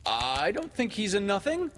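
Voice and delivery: put-on high, eager boy's protest; instant cry. A narrator's reading. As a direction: cry it out high, leaping to his defence. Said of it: "Toodles cried instantly".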